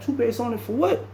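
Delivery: can't believe the advice; incredulous. Retold or imitated.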